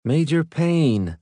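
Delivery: high, slow distant call; calling from far away. Acted. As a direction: call his name from across the room. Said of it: "a voice called from far away".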